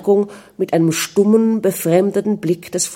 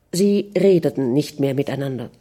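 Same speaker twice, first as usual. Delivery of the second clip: ordinary delivery, nothing marked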